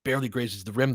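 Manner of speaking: ordinary talk, nothing marked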